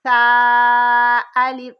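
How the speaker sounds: سا الف slow and loud with a soft s; soft drawn-out سا الف. Shown as a demonstration. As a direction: draw out سا الف without whistling. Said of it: "سا بھی نرم لیٹر ہے".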